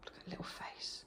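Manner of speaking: soft unvoiced whisper. Real, not acted. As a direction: hush it fondly over the dog. Whispering over the dog.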